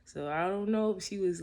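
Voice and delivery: ordinary delivery, nothing marked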